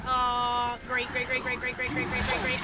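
real annoyance; sarcastic groaning. Real, not acted. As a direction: grumble it in fed-up sarcasm. Hurt and can't see.

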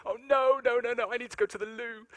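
high, urgent mock panic; retold panic. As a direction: squeal it out like a sudden alarm. Waking drunk, urgent need.

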